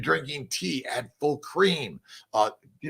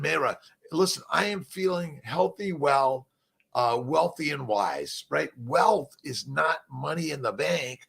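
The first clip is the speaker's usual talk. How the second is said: ordinary delivery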